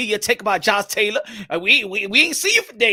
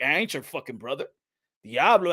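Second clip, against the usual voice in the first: ordinary talk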